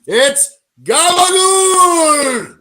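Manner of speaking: mock Italian-American. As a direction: drag it out like a Jersey mobster greeting a pal. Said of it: "what we say to our new Italian friends".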